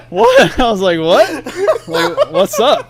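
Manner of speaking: high, loud baffled "what" through laughter; giggling squeaky confusion; laughing confusion. Retold or imitated. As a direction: laugh while asking what's up. Laughing, playing baffled self.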